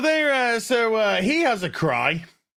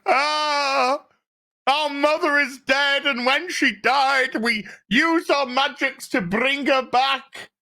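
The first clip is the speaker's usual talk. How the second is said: high, whiny fake wail; hammed-up sobbing lament; mock crying; 4 semitones higher, slower than usual. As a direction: bawl theatrically, stretching out the ahs. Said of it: "have a good old cry".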